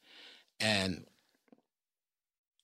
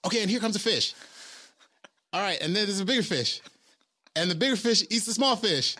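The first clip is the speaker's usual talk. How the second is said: loud, high-pitched shouted cues; yelling; 11 semitones higher, 6 dB louder, faster than usual. Retold or imitated. First A D calling out fish cues to the actors, yelling. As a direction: yell it out across a noisy film set. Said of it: "was yelling at us".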